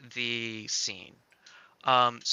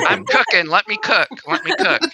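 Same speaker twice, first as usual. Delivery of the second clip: loud, much higher boast with a laugh; playful boast; 13 semitones higher, 12 dB louder than usual. Real, not acted. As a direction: crow it like a proud show-off. Joking boast while editing.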